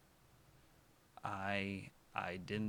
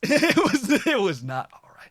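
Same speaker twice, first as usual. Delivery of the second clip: louder, higher laughing outburst; loud laughing burst; 12 semitones higher, 15 dB louder than usual. Pretend. Correcting the whisper to the viewers, loud.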